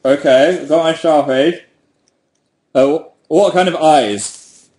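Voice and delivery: talking round a pen held in teeth; words muffled by a pen between the teeth; pen in mouth. Real, not acted. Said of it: "I can't do the mouth thing anymore".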